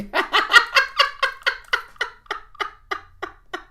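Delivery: big high-pitched airy laugh; real amused laughter; loud high laugh. Real, not acted. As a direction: burst out laughing. Amused by her own song remark.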